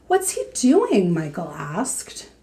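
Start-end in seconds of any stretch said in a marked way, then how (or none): 0.1-1.1 put-on curious child. Boy asks about bear.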